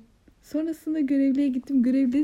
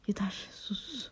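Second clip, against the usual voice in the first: hushed "enough, shut up"; 10 dB quieter, much slower than usual. Retold or imitated. Friend quoting how she was hushed, whispered. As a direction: hiss the order to drop it. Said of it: "Bana böyle bir".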